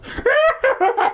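very high, wailing maniac laugh; crazed high cackle. Acted. Hermit laughing as he chases, very high wailing cackle. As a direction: cackle like a madman. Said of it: "high-pitched wails of laughter".

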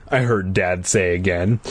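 ordinary delivery, nothing marked

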